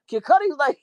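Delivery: ordinary talk, nothing marked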